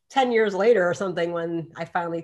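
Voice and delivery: ordinary delivery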